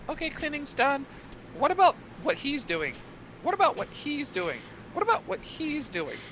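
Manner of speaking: high, singled-minded eager recruit voice; eager pirate recruit. Acted. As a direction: pester for the next job, pitched high. Acts recruit nagging for tasks.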